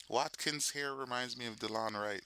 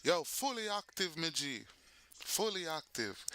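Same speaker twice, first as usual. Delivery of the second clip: higher, stretched Jamaican patois; Jamaican guy greeting; 5 semitones higher than usual. Acted. Guys greeting each other, Jamaican accent. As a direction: hail your friend in Jamaican patois. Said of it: "then we would turn around and say".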